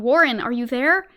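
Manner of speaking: loud, anxious call; shouting into the receiver. Acted. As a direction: call out into the dark, hoping. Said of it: "Again I called down".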